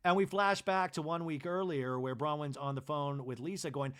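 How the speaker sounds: ordinary delivery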